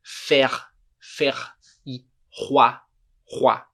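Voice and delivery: "faire" and "roi" with guttural R, spaced out; "roi" as rwa, R scraped at the back; throaty French R. Shown as a demonstration. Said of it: "r com a garganta".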